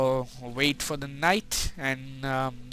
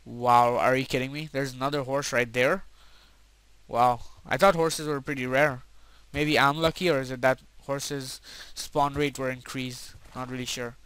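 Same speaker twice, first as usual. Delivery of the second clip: usual speaking voice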